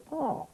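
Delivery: high-pitched surprised "oh"; startled cry. Acted. Reader lifts the flap on a hidden dinosaur, startled cry. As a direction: yelp as if caught by surprise.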